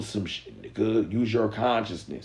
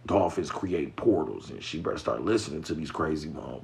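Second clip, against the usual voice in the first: normal talking voice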